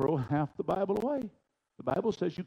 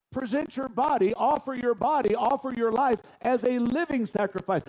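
fervent preaching; 5 semitones higher, 4 dB louder than usual. Acted. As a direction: proclaim it from the pulpit. Preaching scripture.

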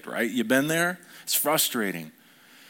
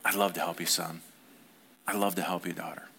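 lower, much softer tender father; 7 semitones lower, 6 dB quieter, faster than usual. Acted. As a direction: speak gently to your child. Father speaking tenderly to child.

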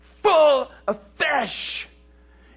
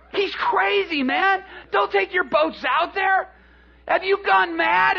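high, loud calls of mocking disbelief; incredulous shouting; 8 semitones higher, 3 dB louder than usual. Fishermen on shore to Peter, shouting in disbelief. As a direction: holler across the water, aghast.

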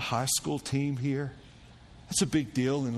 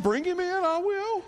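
much higher, louder declaration; bold volunteering; 4 dB louder than usual. Retold or imitated. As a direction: step up and claim the job, loud and high. Pastor volunteers to tell son.

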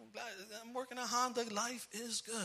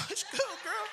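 laughing flirt; 6 dB louder than usual. Retold or imitated. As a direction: tease your wife through laughter.

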